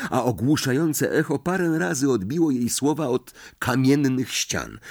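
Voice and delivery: plain reading voice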